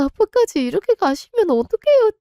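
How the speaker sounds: high, loud despairing wail; 어떡해요 wailed high and loud in despair; anguished cry. Acted. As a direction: cry it out after the departing father. Alone after father leaves.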